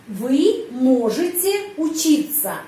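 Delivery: slow, careful teacher model; deliberate classroom pronunciation; slow model sentence. Shown as a demonstration. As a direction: spell out each word slowly for learners. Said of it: "di sini belajar di universitas".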